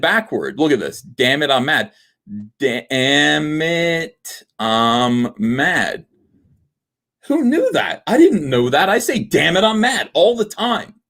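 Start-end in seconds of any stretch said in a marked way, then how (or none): 2.6-6.0 DAMN IT, I'M MAD stretched out very slowly; slow, syllable-by-syllable sounding out; drawn out. Shown as a demonstration. Spells palindrome out slowly. 7.3-11.0 high-pitched astonished outburst; incredulous amazement. Real, not acted. Acts amazed at the palindrome discovery.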